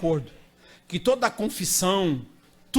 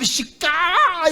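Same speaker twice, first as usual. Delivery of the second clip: higher stretched word; 8 semitones higher, 4 dB louder, slower than usual. Retold or imitated. Describing the screams.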